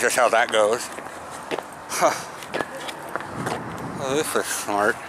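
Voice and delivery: talking around a mouthguard; mouthguard in mouth. Real, not acted. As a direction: lips held open by guard. Seen 1: Green mouthguard clearly in mouth throughout, removed around 21s.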